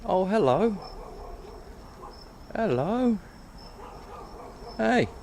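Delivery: high, sing-song cat greeting; talking to a cat. Real, not acted. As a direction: coo hello up high at the cat.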